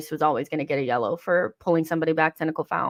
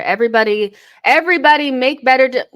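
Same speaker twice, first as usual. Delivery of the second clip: loud, high yell at the players; frustrated yell; 6 semitones higher, 9 dB louder than usual. Real, not acted. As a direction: shout commands at the team in frustration. Streamer yelling at players on screen.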